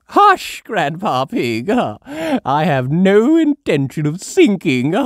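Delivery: high, loud laughing dismissal; Granny laughing. A narrator's reading. Granny Pig laughing at Grandpa's warning.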